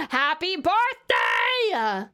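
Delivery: slow, stretched-out birthday shout; celebratory cheer. Real, not acted. Sister celebrating her brother's birthday in chat, celebratory cheer. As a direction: cheer it out, drawing the words long.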